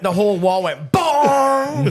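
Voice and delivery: slow loud booming imitation; booming bong. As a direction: boom out the bong sound. Retelling the glass wall impact.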